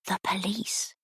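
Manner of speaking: hushed secret whisper. A narrator's reading. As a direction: barely breathe the words. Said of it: "lowered her voice to a decibel only usually heard by bats".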